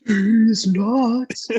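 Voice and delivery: high, drawn-out mock taunt; prank punchline.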